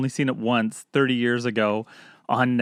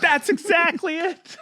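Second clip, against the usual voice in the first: high mock voice; slower than usual. Joking agreement.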